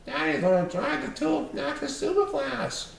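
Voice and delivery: put-on high kids' voice; excited children. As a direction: brag like little kids. Children speak.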